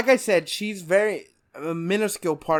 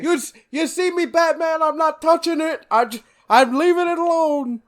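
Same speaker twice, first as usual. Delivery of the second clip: loud, high Brooklyn crook; New York Brooklyn accent; 12 semitones higher, 7 dB louder than usual. Acted. Gotham crook backing away from Batman, loud Brooklyn accent. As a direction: plead innocence in an over-the-top Brooklyn accent. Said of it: "everyone in Gotham has that New York, Brooklyn accent".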